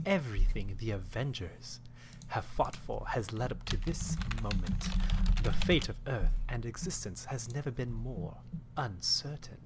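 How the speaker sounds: soft, grave movie-trailer voice; dramatic trailer narration. Acted. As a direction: read it like an epic trailer. Reads synopsis dramatically.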